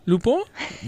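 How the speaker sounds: copying Lupin with a French nasal ending. Shown as a demonstration. Repeating her friend's French Lupin. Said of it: "You're so good at that".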